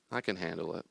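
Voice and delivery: soft, steady reassurance; calm self-talk. Acted. Calm self answering another objection, soft and steady. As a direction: say it quietly, totally unruffled.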